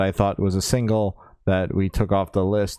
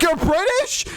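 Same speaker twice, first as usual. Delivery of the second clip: very high, incredulous squeal; mock amazement; slower than usual. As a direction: pretend to gasp it right at the star. Acting out amazement to his face.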